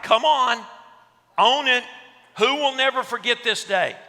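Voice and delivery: high, very loud challenge; mock-outraged shout. Speaker pressing the room for the phone's owner, mock-outraged shout. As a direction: goad the culprit into owning up, hammed-up.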